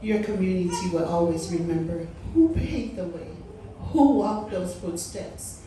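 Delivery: usual speaking voice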